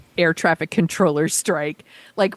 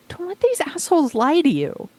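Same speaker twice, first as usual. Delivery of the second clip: pleading; 11 semitones higher than usual. Real, not acted. Pleading with listeners not to vote against themselves.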